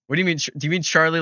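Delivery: usual speaking voice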